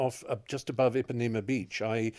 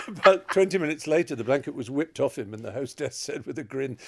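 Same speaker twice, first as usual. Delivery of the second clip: ordinary talk, nothing marked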